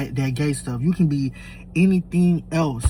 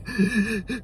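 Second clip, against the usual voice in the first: mock sobbing gasps; imitated crying; 4 semitones higher, 9 dB quieter than usual. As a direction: imitate hitched, gasping sobs. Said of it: "crying, crying".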